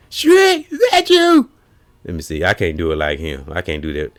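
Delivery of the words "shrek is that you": very high, loud cartoon donkey. Burglar mocking the fake tough voice, high Donkey voice. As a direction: squeak it like Donkey calling Shrek. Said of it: "Said he sound like Donkey from Shrek".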